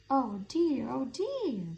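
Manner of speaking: high fretful squeak; nervous little mouse squeak. A narrator's reading. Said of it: "squeaked Mouse".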